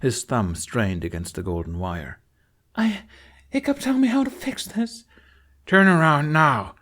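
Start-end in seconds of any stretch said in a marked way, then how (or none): 2.7-5.0 much higher frantic plea; begging for a fix, near panic; desperate. Acted. Astrid panicking over her dragon. 5.6-6.7 louder, slow commanding order; stern command. Acted. Hiccup threatening the captain.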